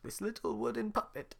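put-on high old man voice; Geppetto voice. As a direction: offer the puppet.

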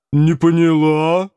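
slow, loud, boomy chest voice; booming chest voice. A narrator's reading. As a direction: boom it from the chest like a giantess. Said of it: "грудным голосом прогудела богатырша".

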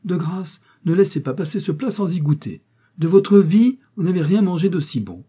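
high, loud, gushing lady; excited, high-pitched hollering; calling out loudly. A narrator's reading. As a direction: urge him insistently from across the table. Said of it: "cria la dame de sa plus grosse voix".

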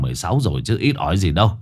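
ordinary delivery, nothing marked

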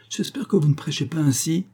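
put-on high-pitched, reproving old mother; exclaiming old lady. A narrator's reading. Mother chiding her preacher son, exclaiming. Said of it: "s'écria la vieille dame".

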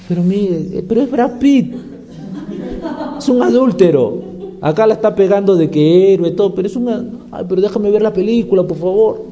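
voz aguda de mujer conmovida, en burla; chica llorosa imitada. Acted. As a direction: protesta como espectadora enamorada del actor. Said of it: "llorando con una película y le digo". (imitación exagerada de chica que llora con una película)

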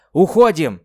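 loud sharp command; shouted order. Acted. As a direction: yell the order. Order to retreat.

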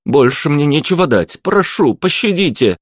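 high, whimpering plea; frightened high-pitched begging; desperate pleading. A narrator's reading. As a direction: beg for mercy, voice climbing. Cornered lad begs for mercy.

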